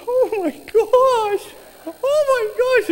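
high, slow, overwhelmed; drawn out, near tears; tearful. Retold or imitated. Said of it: "tears are coming down her eyes".

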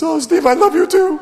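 high, loud tearful gush; mock sob of love through tears; crying. Duncan answering Steve through tears, high and loud. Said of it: "He starts crying and goes".

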